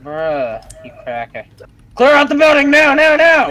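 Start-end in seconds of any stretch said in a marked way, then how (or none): 2.0-3.5 much higher, louder orders; shouted command. Acted. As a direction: bark it like a squad leader. Commanding in game.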